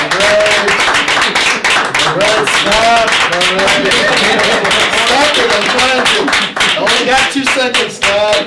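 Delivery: telling the crowd to stop; real loud calling out. Real, not acted. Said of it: "stopping audience laughter".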